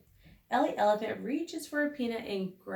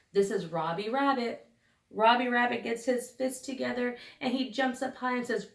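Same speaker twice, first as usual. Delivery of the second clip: ordinary delivery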